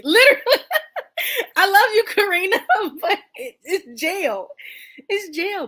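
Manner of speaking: high, loud words through real laughter; can barely get it out for laughing; laughing hard. Real, not acted. Laughing at friend's jail verdict.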